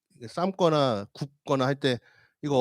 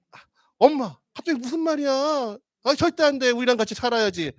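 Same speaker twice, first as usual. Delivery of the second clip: put-on much higher, alarmed child's voice; upset children; 10 semitones higher than usual. Children refusing to let their mother leave, high and alarmed. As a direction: protest like a child who won't let mom go.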